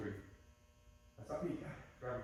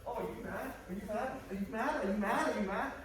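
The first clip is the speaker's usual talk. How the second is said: higher-pitched, repeated rising questions; higher voice, each question lifting at the end; mock concerned questioning; 5 semitones higher than usual. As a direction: play the anxious partner checking in, over and over. Acting concerned partner in argument drill.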